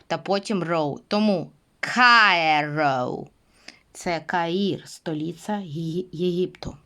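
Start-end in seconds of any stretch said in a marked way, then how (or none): 1.8-3.3 "Кайро" very slowly, kai then ro; slow model word. Shown as a demonstration. Teacher builds the word for the class, drawn out. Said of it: "Вимовляю кай, та потім додаю е".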